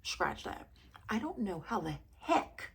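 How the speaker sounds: ordinary talk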